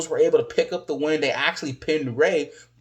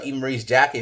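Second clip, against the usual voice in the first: ordinary talk, nothing marked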